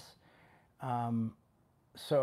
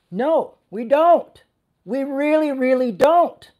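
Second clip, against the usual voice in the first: much louder and higher, slow and insistent; emphatic rebuttal; 10 semitones higher, 12 dB louder, slower than usual. Real, not acted. Preacher to congregation, answering the fearful, emphatic shout. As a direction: hammer home the denial.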